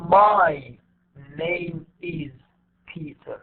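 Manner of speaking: MY, NAME, IS, PETER each stressed separately; slow word-by-word "my ... name is ... Peter", pausing between; every word stressed. Shown as a demonstration. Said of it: "we don't say".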